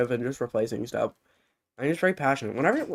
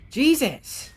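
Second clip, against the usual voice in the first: startled exclamation; 10 semitones higher, 3 dB louder than usual. Real, not acted. Gasping, exasperated outburst.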